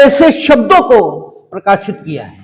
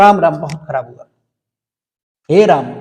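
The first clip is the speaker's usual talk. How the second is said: low, subdued condolence; demonstrated sorrowful commiseration; acted sympathy; 7 semitones lower, 3 dB quieter than usual. Shown as a demonstration. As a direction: lament it gently, dropping the voice. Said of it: "परिस्थिति के प्रति अपनी संवेदना उसे व्यक्त करने".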